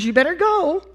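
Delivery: a hint of his mother's warning tone; mom's way of talking. Mother telling son to go, lightly imitated. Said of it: "That's how she talked".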